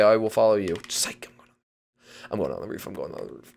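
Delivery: ordinary talk, nothing marked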